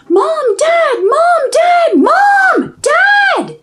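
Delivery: very high, loud panicked yell; yelling for parents. Acted. Girl screaming for her parents as the witch comes. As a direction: scream for mom and dad in terror. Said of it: "Redmond yelled".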